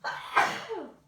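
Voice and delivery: a real sharp sneeze; sneezing. Real, not acted. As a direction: sneeze out loud. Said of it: "sorry, I have to sneeze".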